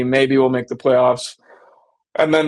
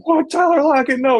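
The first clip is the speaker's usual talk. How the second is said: shocked fans; 11 semitones higher than usual. Retold or imitated. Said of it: "the fans are like".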